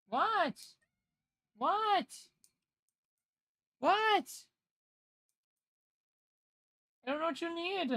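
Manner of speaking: very high sing-song what. Real, not acted. Talking to the cat.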